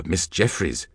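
plain reading voice

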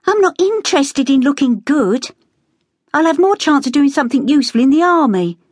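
a sister telling off the lads for laughing at war news; Becky scolding the boys. A narrator's reading.

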